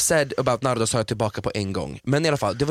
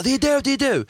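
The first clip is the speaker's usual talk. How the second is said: high, pointed accusing voice; mock accusation; 11 semitones higher than usual. Contestant accusing another of being Mafia, mock shrill. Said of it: "Och bara".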